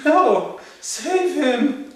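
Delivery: high, loud wail of protest; mock anguished cry. Reacting to the dog scene, mock anguished cry. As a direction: cry out in exaggerated despair.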